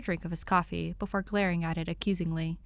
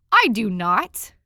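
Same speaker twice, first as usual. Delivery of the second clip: 1 indignant denial; 3 semitones higher, 11 dB louder, slower than usual. A narrator's reading. Dick protests Tim's teasing.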